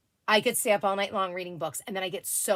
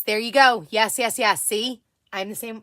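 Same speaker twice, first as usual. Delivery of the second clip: ordinary talk, nothing marked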